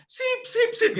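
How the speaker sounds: high falsetto "sip, sip" of approval; mock pleased. Punchline of the joke, high falsetto approval. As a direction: purr it as cheeky approval. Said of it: "hannya hilang".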